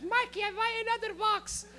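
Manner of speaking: put-on high, accented mom announcement; high mock-mom voice; immigrant mom. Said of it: "She would just buy boxes".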